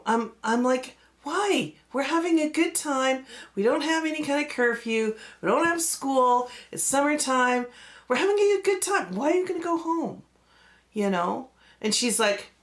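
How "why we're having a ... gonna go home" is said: put-on high, fast kid pleading not to leave; childish high-pitched whine of disbelief; pleading protest. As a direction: beg your friend to stay, voice jumping up. Child pleading with scared friend.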